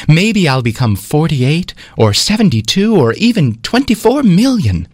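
a vain number dreaming big, climbing in pitch; proud excitement. Acted.

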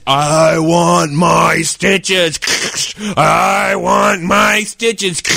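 very slow, drawn-out zombie groan; moaning monster. Retold or imitated. Glenn voicing Igor at the doctor's door, slow moan. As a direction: moan it like a headless ghoul. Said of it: "and he says".

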